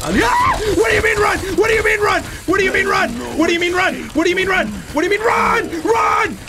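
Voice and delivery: high, shouted repeated disbelief; panicked screaming. Real, not acted. Player suddenly told to run, panicked screaming. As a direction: scream the question over and over.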